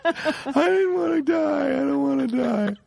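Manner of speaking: high, drawn-out wail through laughter; laughing imitation of sobbing; mock crying. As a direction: wail like a hammed-up coward. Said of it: "they all start crying".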